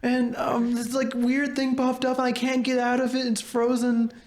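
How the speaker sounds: very high squeaky imitation of a clip; high-pitched parody of a viral clip; mock video voice. Continuing video quote.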